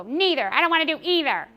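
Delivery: mock a child digging in their heels; whiny child refusing. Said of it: "And if they go".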